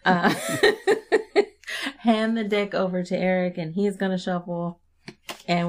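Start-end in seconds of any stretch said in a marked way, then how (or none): 0.0-3.6 laughing. Real, not acted. Giggling after her voice cracked.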